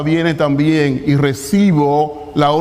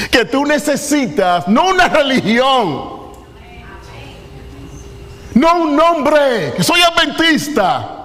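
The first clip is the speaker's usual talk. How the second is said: full-force preaching; 7 semitones higher than usual. Acted. Pastor urging the congregation to give their hearts, preaching at full force. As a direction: preach it with rising fervour to the congregation.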